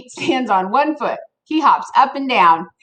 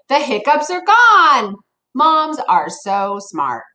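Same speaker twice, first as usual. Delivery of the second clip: ordinary delivery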